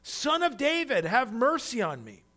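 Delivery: loud, high plea for mercy; crying out. Acted. Blind beggar crying out to Jesus, loud and high. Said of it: "But he cried out all the more".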